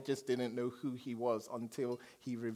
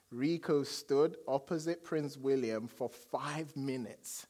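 ordinary talk, nothing marked

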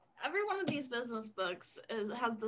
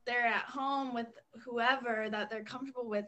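ordinary talk, nothing marked

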